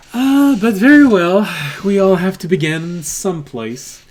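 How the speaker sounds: a hint of a jovial barkeep; character voice. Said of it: "When you use his voice".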